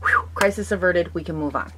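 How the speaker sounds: usual speaking voice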